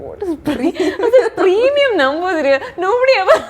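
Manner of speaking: high, laughing outburst; laughing in disbelief. Real, not acted. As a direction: squeal the words through real giggles. Retelling her laughing fit.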